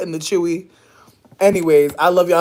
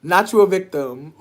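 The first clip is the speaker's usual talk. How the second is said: ordinary talk, nothing marked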